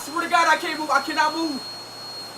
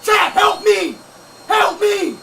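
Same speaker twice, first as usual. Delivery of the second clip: yelling for help; much slower than usual. Real, not acted. Streamer stuck, yelling to his mom and chat for help. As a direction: shout for your mom like you're stuck.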